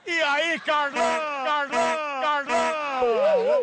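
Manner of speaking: slow, drawn-out calling of the name. Acted. Prankster calling a stranger by the wrong name, slow loud call. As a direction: call him like an old friend, stretching it.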